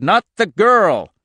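slow, high, loud shout; alarmed shout. A narrator's reading. Al repeating his order to the men, slow urgent shout. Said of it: "Al shouted".

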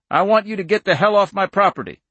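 high, loud, angry demand; angry order. A narrator's reading. Farmer orders agents off his land, angry.

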